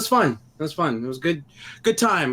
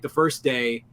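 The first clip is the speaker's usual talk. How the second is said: normal talking voice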